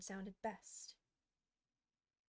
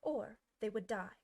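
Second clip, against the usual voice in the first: plain reading voice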